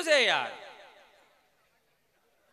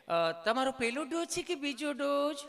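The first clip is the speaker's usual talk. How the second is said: lightly higher, polite nurse voice; a hint of a sweet nurse asking; nurse's voice; 4 semitones higher than usual. (હળવો નર્સનો મીઠો અવાજ)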